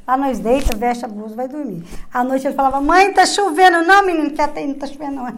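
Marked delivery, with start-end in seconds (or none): 2.8-3.8 a son's high, slow, worried call in the night; child calling mum. Retold or imitated. Little son wakes his mother in the tent, high and slow. 3.9-5.3 quick, impatient brush-off; dismissive mother. Retold or imitated. Mother tells her son it isn't raining, quick and impatient.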